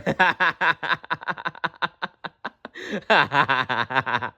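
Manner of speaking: long rolling laugh that falls away; both laughing harder together. A narrator's reading. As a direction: laugh out till it fades. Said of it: "they both laughed louder".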